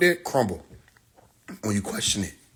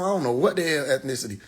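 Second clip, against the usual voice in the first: ordinary delivery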